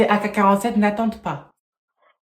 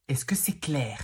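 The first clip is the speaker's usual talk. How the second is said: low whispered threat; 6 semitones lower, 11 dB quieter, slower than usual. Acted. Teacher warning the class about phones, whispered. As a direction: menace them in a whisper.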